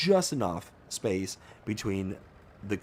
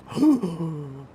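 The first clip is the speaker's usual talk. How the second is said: sharp startled gasp; a quick gasp of alarm; 7 semitones higher, 3 dB louder than usual. Retold or imitated. Driver reliving the cop pulling out, gasping. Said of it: "And I'm like".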